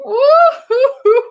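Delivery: high, loud hammed-up boo-hoo wail; mock fox sobbing. A narrator's reading. Fox at the campsite wails over its wet sleeping bag. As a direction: wail like a crying storybook fox. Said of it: "a fox who was crying".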